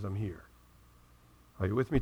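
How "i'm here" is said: very soft and low; tender reassurance. Retold or imitated. God answering a hopeless believer, very soft and tender. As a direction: hush it down, tender and close.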